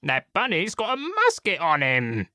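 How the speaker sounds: high, whiny Cockney henchman; whining Cockney wolf sidekick. A narrator's reading. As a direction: whine it up high to the boss in Cockney. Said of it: "the gray one with a whiny voice and the same kind of accent".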